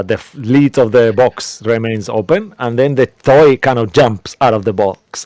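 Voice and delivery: usual speaking voice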